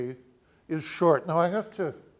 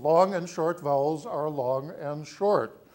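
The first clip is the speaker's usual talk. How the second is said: ordinary talk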